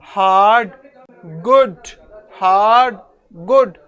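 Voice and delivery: हार्ड and गुड each in one unbroken beat; one-syllable demo. Shown as a demonstration. Teacher showing students words without a break, हार्ड and गुड each in one beat. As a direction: say हार्ड, then गुड, as single firm syllables, pausing between. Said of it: "उसको pronounce करते हुए".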